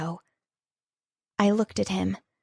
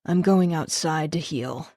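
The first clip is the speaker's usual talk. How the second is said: lower, without emotion; flat, emotionless; 4 semitones lower than usual. A narrator's reading. Rafe leaves to heal, flat and emotionless. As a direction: say it with nothing behind it. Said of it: "his voice flat, devoid of emotion".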